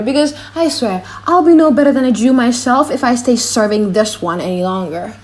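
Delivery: usual speaking voice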